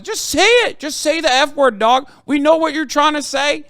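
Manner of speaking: high, loud exasperated rant; heated rant. Real, not acted. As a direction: streamer yelling at tweeters, heated and loud. Ranting at critics on stream.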